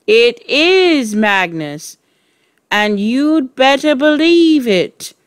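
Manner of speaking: slow, drawn-out voice rising in pitch; eerie, insistent haunting voice; ghostly message. A narrator's reading. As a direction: intone it as a warning from beyond. Ghostly chalk answers back.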